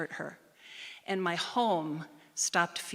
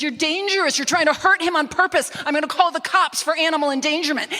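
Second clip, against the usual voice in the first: much higher, much louder shrieking accusation; hysterical accusation; 9 semitones higher, 11 dB louder, faster than usual. Retold or imitated. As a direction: accuse her at the top of your voice. Housemate voiced accusing in fight.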